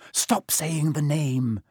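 slow, scared whispered plea; whispered fear, urgent and slow; terrified whisper. Acted. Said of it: "in a terrified whisper".

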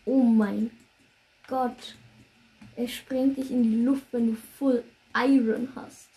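loud stunned disbelief; shocked loud outburst. Real, not acted. Shocked at creeper death. (fassungslos und laut)

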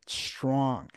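sarcastic air quotes on Strong. Shown as a demonstration. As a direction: say Strong with ironic quote marks. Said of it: "I'm gonna be doing air quotes right now".

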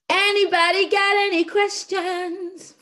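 high, loud slow call-out. Real, not acted. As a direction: call it out to the room. Host opens the floor.

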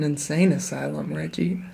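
laughing whisper. Real, not acted.

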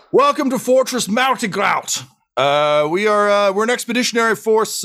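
high-pitched, hollered dwarf welcome; boisterous dwarf host. Acted. Dwarf greets visitors to the expedition's habitat, boisterous. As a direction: holler a hearty welcome across the hall.

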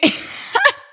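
carcajada aguda, casi sin voz. Real, not acted. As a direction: se ríe con la voz muy aguda. Reacción de incredulidad. (carcajada aguda sin voz)

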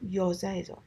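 ordinary delivery

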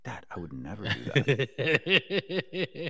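slow, laughing fake-innocent denial; mock innocence. Kid lying to his dad, slow and mock innocent. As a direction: play the angel who did it.

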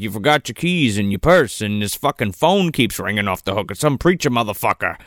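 lightly voiced unfazed man; Joe. Retelling Joe at the car, low unfazed imitation. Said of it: "He's like".

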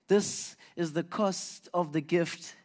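slow, weighty delivery; slowed-down sermon climax; slow emphasis. Real, not acted. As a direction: let each word land heavily.

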